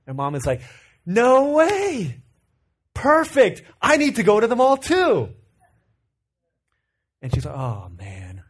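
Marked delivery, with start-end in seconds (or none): 1.0-5.3 high, loud, delighted mom; eager mom voice. Retold or imitated. 7.5-8.4 deflated groan. Retold or imitated. Daughter disappointed in skit.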